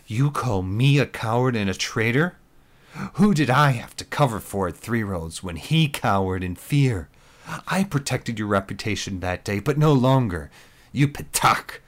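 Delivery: loud, furious accusation; spat in fury. Acted. Lisa hurling accusations at Mike, loud and spitting. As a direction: spit the words at him. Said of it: "she spat".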